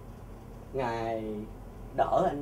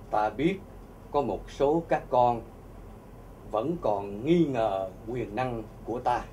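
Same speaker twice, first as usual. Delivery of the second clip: voice of God; 5 semitones lower, 5 dB quieter, slower than usual. Acted. Voicing Jesus speaking to him.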